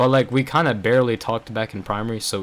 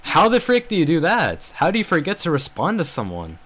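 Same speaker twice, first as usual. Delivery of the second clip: higher-pitched incredulous questioning; exasperated disbelief; 5 semitones higher than usual. Real, not acted. Vlogger challenging friend's excuse for not replying, exasperated. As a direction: demand an answer in disbelief.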